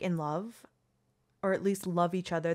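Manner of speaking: ordinary talk, nothing marked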